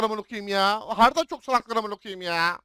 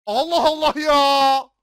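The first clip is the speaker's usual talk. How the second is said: exasperated; 4 semitones higher, 8 dB louder, slower than usual. Real, not acted. Exasperated at teammates.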